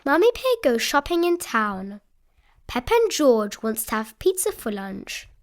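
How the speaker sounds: plain reading voice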